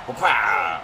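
loud grunting whoop; effort yell. Real, not acted. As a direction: heave yourself up with a shout. Getting back up, effort yell.

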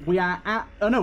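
normal talking voice

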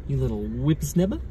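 mild Aussie teasing; Australian accent. Pretend. Repeating a running joke, Australian accent. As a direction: tease with a put-on Aussie twang. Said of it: "Tommy always says to say it".

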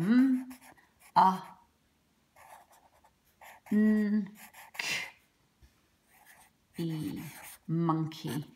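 monkey split into m, uh, n, k, e; sounding out. Shown as a demonstration. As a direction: segment the sounds one by one. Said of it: "It's the o making the uh sound".

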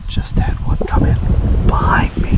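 urgent whisper. Real, not acted. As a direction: hiss it out, unvoiced. Groundhog appears behind him.

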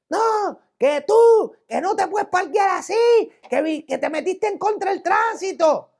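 high-pitched, mock-agitated telling-off; agitated scolding. Agitated driver scolds him over parking, mimicked agitated scolding. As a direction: imitate a worked-up driver scolding. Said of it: "veo al tipo bien agitado".

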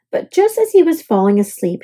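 ordinary delivery

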